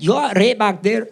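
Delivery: put-on Scottish accent, parroting the customer; mimicked Scottish accent. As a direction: accidentally copy a Scottish accent. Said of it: "I will mimic somebody's accent".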